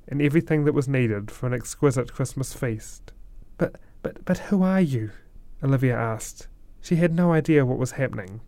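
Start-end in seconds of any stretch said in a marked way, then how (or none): 3.6-4.2 breathless stammered whisper; stunned whisper. A narrator's reading. Olivia stunned by gifts. 4.2-5.1 high, astonished question; amazed question. A narrator's reading. Olivia stunned by gifts.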